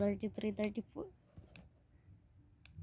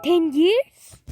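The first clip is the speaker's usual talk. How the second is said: high, loud disbelieving yelp; shocked shout; 10 semitones higher, 18 dB louder than usual. Real, not acted. Vlogger shocked by the wheel's answer, loud high yelp. Spinning a billionaire prediction wheel.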